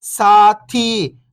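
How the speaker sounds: whole word, level then falling at the end; syllables blended. Shown as a demonstration. Teacher showing beginners the joined word, syllables blended. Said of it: "तो मिलके बन जाएगा".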